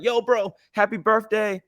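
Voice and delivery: high, bouncy brother voice; imitated excited greeting. Brother phoning on his birthday, imitated high excited voice. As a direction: mimic an excited birthday call.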